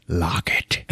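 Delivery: low, soft acted command; imitated tense warning; hushed order. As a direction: say it low and under your breath. Acts Stone backing out warily.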